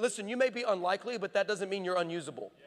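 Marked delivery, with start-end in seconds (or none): none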